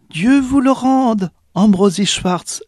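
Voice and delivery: high, slow, gentle thanks; grateful poor mother. A narrator's reading. Poor mother thanks the cooper, high and slow. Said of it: "dit la pauvresse".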